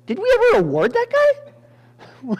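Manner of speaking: high, loud, drawn-out king's question; startled realization. Acted. King realizing Mordecai went unrewarded, mock startled. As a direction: ask in comic sudden alarm.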